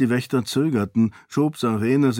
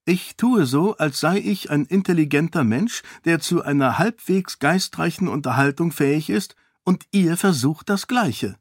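higher, candid, unbothered; frank princess; 6 semitones higher than usual. Acted. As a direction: speak freely to the king. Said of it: "erklärte Sarene freimütig". (freimütige Prinzessin)

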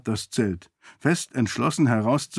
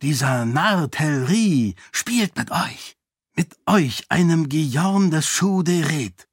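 scornful zealot priest; 2 semitones higher than usual. Acted. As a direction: lean on each word like a fanatic lecturing his superior.

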